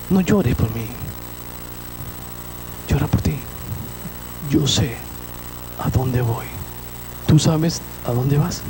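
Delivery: tender Jesus. Retold or imitated. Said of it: "¿y el Señor qué le dice?".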